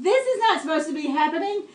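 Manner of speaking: alarmed. Retold or imitated. Car breaks down on highway.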